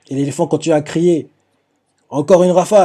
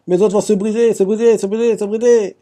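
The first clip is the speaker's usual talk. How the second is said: higher, repeated wail of a lightly voiced skeleton; a hammed-up Brock, higher; Brock's lament; 5 semitones higher than usual. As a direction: moan it over and over, pitched up. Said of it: "on voit Brock qui fait".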